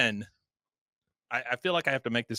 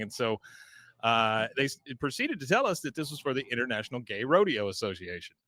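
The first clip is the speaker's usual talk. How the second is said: normal talking voice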